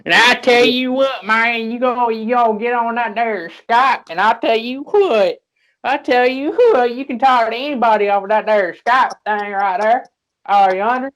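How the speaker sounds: mock hillbilly. Mocking Skype users as a hollering old hillbilly. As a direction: ham up a backwoods old-timer.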